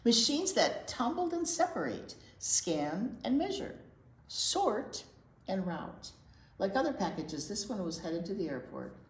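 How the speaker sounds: steady storytime read-aloud voice; gentle storybook narration; picture book reading. Acted. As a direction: read it to the little ones, clearly.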